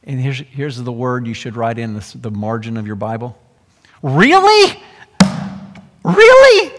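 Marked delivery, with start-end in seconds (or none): 4.0-6.7 incredulous. Acted. Jesus incredulous at the father.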